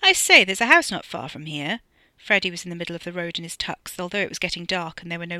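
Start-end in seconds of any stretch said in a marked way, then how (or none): 0.0-1.8 loud, hearty hail; plummy, carrying announcement; calling out. A narrator's reading. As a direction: call it across the road. Calls out from the road.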